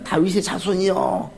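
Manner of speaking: drawn-out, mild mock cry; slow retold call without real volume. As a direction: imitate a beggar's call, slowly stretched. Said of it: "그래서 바디메오가 외칩니다".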